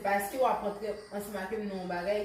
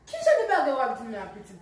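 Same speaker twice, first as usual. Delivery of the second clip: irritated; 2 semitones higher, 7 dB louder, faster than usual. Acted. Irritated questioning of the boyfriend.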